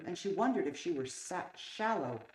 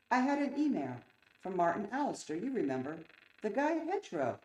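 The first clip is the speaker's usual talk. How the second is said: higher, louder telling of good news; excited news; 6 semitones higher, 4 dB louder than usual. A narrator's reading. Stephen hearing about the email from Hedgerow, excited. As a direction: share the good news eagerly.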